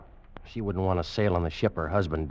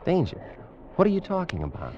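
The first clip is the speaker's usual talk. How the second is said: much higher, shocked question; startled disbelief; 12 semitones higher than usual. Acted. Mate hears the wife's warning, startled. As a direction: jump in alarmed disbelief.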